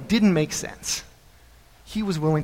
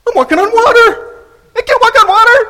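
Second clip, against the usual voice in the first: very high, loud panicked yell; hammed-up terror, yelling at full pitch; mounting panic; 12 dB louder than usual. Peter realizing where he stands, yelling in panic.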